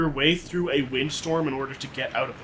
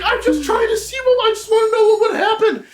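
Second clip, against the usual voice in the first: very high, fast, laughing excuse; innocent excuse; 6 dB louder than usual. Acted. Man explaining himself to the angry pterodactyl. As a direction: plead innocent curiosity.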